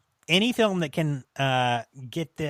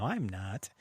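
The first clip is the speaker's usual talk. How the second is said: usual speaking voice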